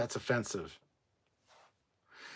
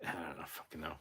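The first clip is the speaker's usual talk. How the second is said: ordinary talk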